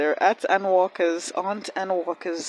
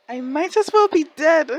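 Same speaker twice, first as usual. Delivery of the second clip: high, loud mock despair; hammed-up dying of feelings; dramatic overwhelm; 10 semitones higher, 8 dB louder than usual. Real, not acted. Overwhelmed by the scene.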